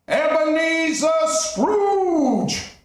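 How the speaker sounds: high, drawn-out ghostly call; eerie ghost voice. Acted. Marley's ghost calling Scrooge from afar, eerie and drawn out. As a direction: call him slowly from beyond the grave. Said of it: "the voice said one more time".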